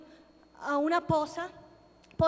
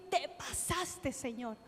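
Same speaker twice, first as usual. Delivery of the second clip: ordinary talk, nothing marked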